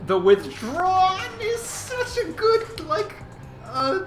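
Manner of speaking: very high, stretched-out gushing; fangirling in a high voice; excited squeal. Real, not acted. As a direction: squeak with delight, groping for the word. Gushes over a faction name.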